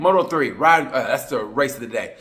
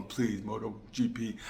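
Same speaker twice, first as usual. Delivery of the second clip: slow, softer, whimpering beg; mock crying plea; 2 semitones lower, 9 dB quieter than usual. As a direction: ham up a lonely fan begging in bed. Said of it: "I'm crying in my bed going".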